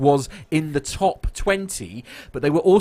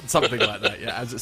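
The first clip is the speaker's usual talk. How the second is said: ordinary talk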